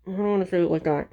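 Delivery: quick, hurried run-through of the line; rushed retake. Shown as a demonstration. Vlogger rushing a retake of the line, fast. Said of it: "like, super cringy".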